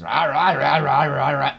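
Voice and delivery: loud, high rumbling rah rah rah; imitated dog growl. Imitating a dog purring at the petter. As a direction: growl out a Rottweiler purr. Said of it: "It sounds just like that".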